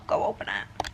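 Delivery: hushed whisper, low; whispered urging. Real, not acted. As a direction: urge him on under the breath.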